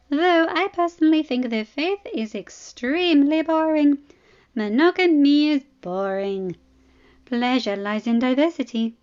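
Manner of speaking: provocative princess. Acted. Arianne teasing provocatively.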